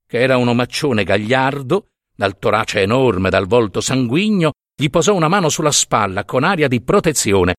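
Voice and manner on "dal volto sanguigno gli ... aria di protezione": ordinary delivery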